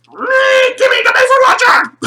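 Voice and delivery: high, loud strained yell; over-the-top frustrated screech; frustrated shout. Retold or imitated. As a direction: scream it at a stuck toy. Acting out fight with stuck toy.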